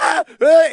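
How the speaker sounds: short, high, loud mock shrieks; mimicked outrage shriek. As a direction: let out two quick outraged squawks. Mimicking instant outrage without dialogue.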